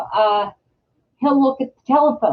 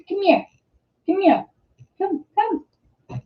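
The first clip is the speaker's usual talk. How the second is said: higher coaxing call to the dog; 8 semitones higher, 4 dB quieter than usual. Real, not acted. Owner calls dog Ranger over, high coaxing pet voice. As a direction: call the dog over, pitched up and soft at the end.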